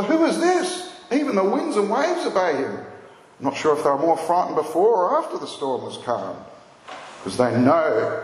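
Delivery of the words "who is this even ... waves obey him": high-pitched amazed question; astonished questioning. Acted. Disciples asked each other after the storm, astonished. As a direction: marvel aloud, pitch leaping high then falling. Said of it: "asked each other".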